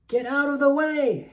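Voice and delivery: loud, high sheep yelling from his Jeep; high, loud character shout; storybook yell. A narrator's reading. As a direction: yell it out to clear the road. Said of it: "he yells with a beep".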